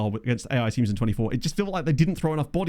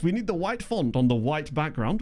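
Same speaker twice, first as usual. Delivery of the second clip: usual speaking voice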